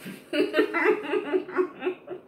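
high giggly laugh. Real, not acted. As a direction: laugh with a full mouth. Laughs while eating.

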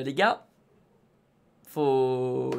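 ordinary delivery

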